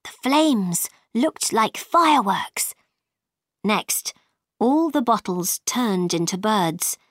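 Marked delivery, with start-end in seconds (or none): none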